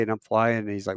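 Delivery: ordinary talk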